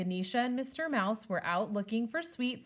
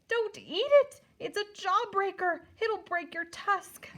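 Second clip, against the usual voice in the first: high, loud squeaky cry; tiny mouse shouting a warning; 11 semitones higher, 3 dB louder than usual. Acted. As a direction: squeak it out like a frantic little mouse. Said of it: "squeaked Mister Mouse".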